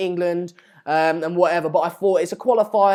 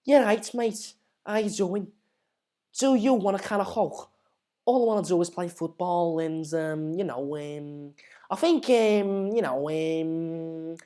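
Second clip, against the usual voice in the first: soft, slurred Scouse with drawn-out ums; quiet Scouse, lingering on the fillers; Scouse accent; 4 dB quieter than usual. Acted. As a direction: put on a hesitant Scouse footballer's accent. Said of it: "I do Scouse, right?".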